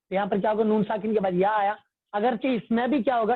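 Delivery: ordinary talk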